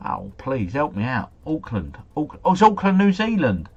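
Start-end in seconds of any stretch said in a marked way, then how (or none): 2.2-3.7 higher, louder burst as it dawns; real surprised discovery; sudden realization. Real, not acted. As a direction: blurt it out, pitch leaping up. Sudden realization after searching wrong country.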